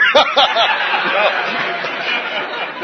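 long airy chuckle; breathy laugh. Real, not acted. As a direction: laugh at his own dodge. Laughs at his own trick.

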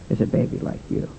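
tender, hushed reassurance; hushed tender words. Retold or imitated. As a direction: breathe it out gently to a scared daughter. Father tenderly reassuring frightened daughter.

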